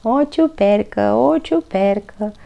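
mock him in singsong; singsong teasing. A narrator's reading. Albert taunts Toni while hopping, singsong teasing. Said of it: "îl necăjea Albert".

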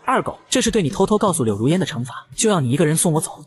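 usual speaking voice

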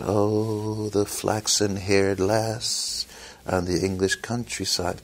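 slow, soft mock-dreamy ballad crooning; mock ballad singer. Mocking bands that sing gentle ballads, slow soft mock crooning. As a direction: lightly send up a sappy folk balladeer.

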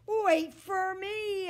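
slow, high, loud yell. A narrator's reading. Dog calling after Fred jumping into the lake, loud yell. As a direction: shout after him desperately. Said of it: "I yell".

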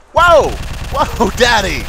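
startled high shriek, then laughing; a jump-scared yelp breaking into laughter; frightened shriek. Real, not acted. Startled by monster walking in.

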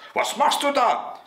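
put-on high, childish call. A narrator's reading. Little boy to rooster on the tower, high call. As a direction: call up to someone in a squeaky boy's voice. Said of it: "rief der kleine Häwelmann hinauf".

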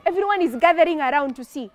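plain reading voice

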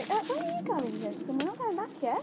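high call after the dog. Real, not acted. Calling the wandering dog.

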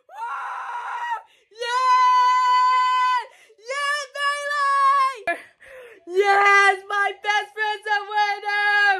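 high excited screaming; screaming with joy. Real, not acted. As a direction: scream for the winner. Best friend wins, cheering.

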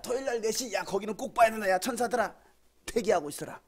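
lightly hammed-up delighted God; God's excited voice. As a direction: play God thrilled to find a good channel. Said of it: "이렇게 돼야 되잖아".

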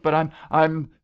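high, loud, faltering panic; choked out. A narrator's reading. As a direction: stammer it out while sinking. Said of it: "she choked".

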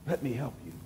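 God's offer repeated gently to the congregation, hushed; soft echo. Acted. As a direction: let the repeat drop almost to a hush. God's offer echoed softly.

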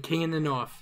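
put-on understated northern Westerosi accent; deadpan Westerosi accent. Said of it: "that was a northern Westerosi accent".

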